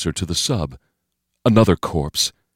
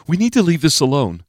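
higher, uneasy younger-man voice; anxious pleading; 8 semitones higher than usual. A narrator's reading. Steve begging off from Bo Pan, anxious higher voice. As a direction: urge it with the pitch lifted.

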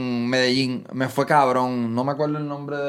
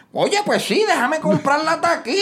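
imagined viewer; 13 semitones higher than usual. Pretend. Imagined viewer deciding to buy.

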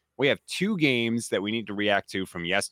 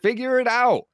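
exasperated rant; 10 semitones higher, 4 dB louder than usual. Real, not acted. Host rants about Browns' playcalling.

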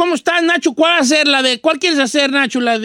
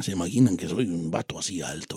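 very low, softer handsome-announcer tone; serious deep voice; 11 dB quieter than usual. Shown as a demonstration. Don Cheto explaining what listeners imagine, serious deep voice. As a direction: sink it down like a suave tall man. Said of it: "cuando yo hablo así".

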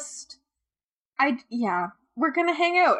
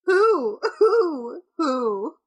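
rising, louder cries of who; dramatic calling out; 4 semitones higher, 5 dB louder, much slower than usual. Acted. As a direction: call out melodramatically. Mock dramatic investigation.

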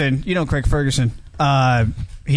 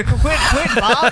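high pleading voice; high mock whine at Bob; mock whiny plea; 7 semitones higher, much slower than usual. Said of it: "I still say".

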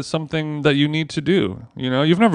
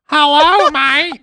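high, loud Aussie hello that slips toward Liverpool; overdone Aussie accent; 4 dB louder, slower than usual. Pretend. Greeting a local in a botched accent, high loud mock Aussie. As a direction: overshoot a cheery Aussie greeting. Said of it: "too Beatlesy. Pull it back".

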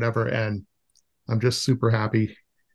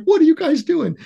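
very high, louder exasperated question; upset scolding; 5 dB louder than usual. Retold or imitated. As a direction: replay losing it at the kids. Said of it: "I got really upset".

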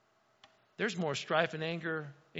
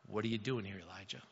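hushed voice of God; 9 semitones lower, 9 dB quieter, faster than usual. Acted. God's voice asks Elijah in cave.

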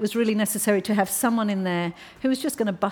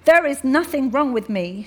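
louder and higher, a trembling angry outburst; 6 semitones higher, 6 dB louder than usual. Acted. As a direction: snap back at the men who doubt her. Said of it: "she said angrily".

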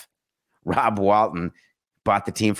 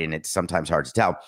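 ordinary talk, nothing marked

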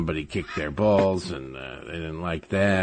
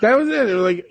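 laughing high; 13 semitones higher, 6 dB louder, faster than usual. Real, not acted. Laughing at daughter's sudden switch.

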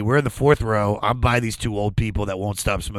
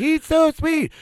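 very high falsetto coo; squeaky mock adoration; gushing mom; 3 dB louder, slower than usual. Acting the moms again.

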